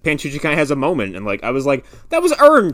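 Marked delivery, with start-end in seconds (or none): 2.1-2.7 excited. Retold or imitated. Reacting to the scene.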